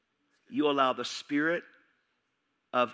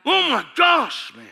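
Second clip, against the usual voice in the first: high, loud, slow cry of shock; shocked shout; 13 dB louder, slower than usual. Retold or imitated. Preacher reliving his alarm at the movie, loud shocked cry. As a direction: draw it out, horrified and loud.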